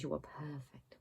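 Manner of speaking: soft breathy whisper of the mug's words. Real, not acted. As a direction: whisper it like a secret. Reading the words off the mug.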